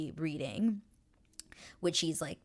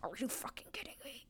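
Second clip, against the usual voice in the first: whispered mock yell, quicker and higher; pissed-off whisper; 4 semitones higher, 9 dB quieter, faster than usual. Man left in the dust, whispering in mock fury. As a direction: hiss it like a hushed outburst. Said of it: "he is pissed 'cause he's like".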